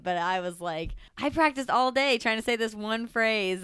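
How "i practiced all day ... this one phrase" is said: raised, louder exasperated protest; retold frustration. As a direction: replay your exasperation, pitched up and loud. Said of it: "it was so frustrating".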